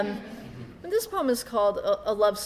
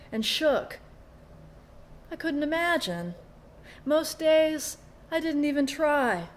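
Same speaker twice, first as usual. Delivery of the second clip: lilting verse delivery, phrases falling at the ends; poetry reading; 4 semitones higher, 7 dB louder, slower than usual. Acted. Poet recalls a rosary and faith, measured poetry reading. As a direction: recite it line by line with pauses between. Reads own poem aloud.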